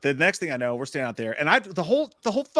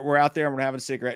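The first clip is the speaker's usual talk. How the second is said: ordinary talk, nothing marked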